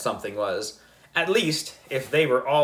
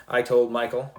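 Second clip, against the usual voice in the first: ordinary delivery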